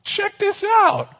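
very high, drawn-out falsetto call; mock show-off calling to his mates in a squeaky high voice; hammed-up Simon Peter. Peter showing off to the men in the boat, in a high, comic falsetto. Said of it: "and he's like".

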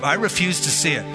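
usual speaking voice

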